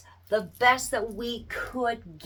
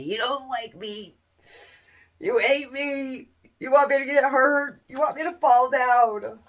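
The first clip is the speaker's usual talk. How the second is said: louder blustering cartoon cowboy rant; Yosemite Sam; 2 semitones higher, 6 dB louder than usual. Toddler cussing out the parent, blustering cartoon voice. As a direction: sputter like an angry cartoon cowboy. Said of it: "sound like Yosemite Sam".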